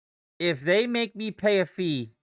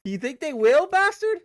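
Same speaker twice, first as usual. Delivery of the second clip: mocking squeal; 11 semitones higher, 5 dB louder than usual. Real, not acted. Mocking the gas company.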